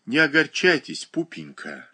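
ordinary delivery

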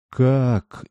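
high astonished gasp of a question; amazed. Acted. Princess to poor woman, amazed. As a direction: princess cannot believe her ears. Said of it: "изумилась принцесса".

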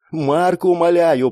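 desperate imploring. Acted. Girl begs Mark to picture the mirror, desperate. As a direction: implore him to listen.

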